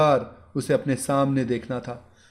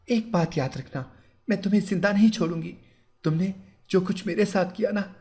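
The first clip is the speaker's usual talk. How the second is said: higher, threatening bride, restrained; mild menace, voice pitched up; angry bride; 6 semitones higher than usual. Acted. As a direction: bride threatening her groom, restrained anger. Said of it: "वो गुस्से में बोली".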